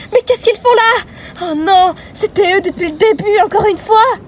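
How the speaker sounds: high loud alarm; high shocked outburst. Acted. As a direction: gasp it in disbelief. Friend shocked at villains.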